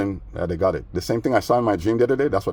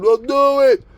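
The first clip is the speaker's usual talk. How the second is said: high, loud screeching dare; shrieked dare; 9 dB louder, much slower than usual. Retold or imitated. Roommate daring him to call the cops, high loud screech. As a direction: fling it back at him, shrill with rage.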